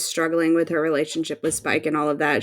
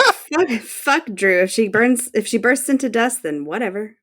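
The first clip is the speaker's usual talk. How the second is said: dismissive shrug; 6 semitones higher than usual. Retold or imitated. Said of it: "mine just said".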